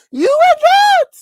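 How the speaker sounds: very high, loud playful holler; teasing shout. Pretend. Teasing a co-host who missed the story, high playful shout. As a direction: holler it like a mock taunt.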